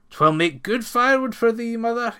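put-on loud, high proud young man; a big lad shouting his pride to his mother; triumphant son. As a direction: boom it out in triumph. Son's triumphant boast to mother.

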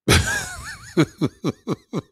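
shrill falsetto burst of laughter; real squeal of laughter; high squealing laugh. Real, not acted. Reacting to a chat message.

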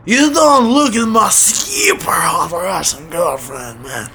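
slow, hammed-up Russian tough guy, half-shouted; sketchy Russian accent. Russian neighbor warns him off his girlfriend, in a hammed-up Russian accent. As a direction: bark it like a sketchy Russian heavy. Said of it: "in a Russian accent".

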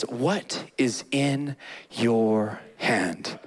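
low, slow, solemn divine question "What is in your hand?"; slow deep voice of God. Acted. God asking Moses slowly and deeply. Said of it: "He says".

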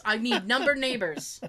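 ordinary talk

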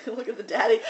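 usual speaking voice

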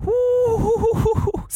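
high squealing woo at the bill; reading a scary student loan bill, high woo; shocked woo. Retold or imitated. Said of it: "I was like".

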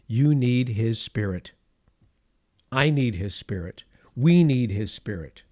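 ordinary talk

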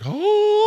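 very high, loud, drawn-out mock wail; imitated sobbing wail. Son imitating his dad and uncle at the coffin, sobbing wail. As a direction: imitate grown men bursting into sobs. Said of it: "I watched them sob crying".

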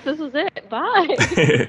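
giggling fake goodbye; laughing. Pretends to end the interview.

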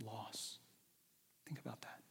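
soft hushed whisper. Acted. Preacher whispering to the listeners about heaven. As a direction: breathe it to the congregation.

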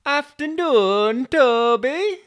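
high, loud, drawn-out greeting; delivery driver. Acted. Driver greeting Toby on arrival, high loud put-on voice. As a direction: call it out high, slow and loud. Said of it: "the delivery driver arrived".

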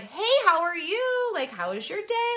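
bright, smiling hello; cheerful phone greeting. Shown as a demonstration. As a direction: greet them with a smile in your voice. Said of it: "you know, like".

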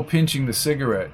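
usual speaking voice

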